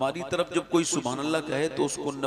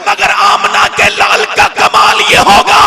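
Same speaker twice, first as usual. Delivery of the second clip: full-force shouting sermon, very high; shouted preaching; 12 semitones higher, 15 dB louder than usual. Acted. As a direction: roar the climax at the crowd. Sermon climax, shouted to crowd. (पूरी ताक़त से चिल्लाकर तक़रीर)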